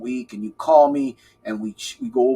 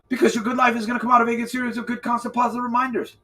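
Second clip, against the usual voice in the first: fast and laughing; 7 semitones higher, faster than usual. Shown as a demonstration. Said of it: "I'll say it in slow motion".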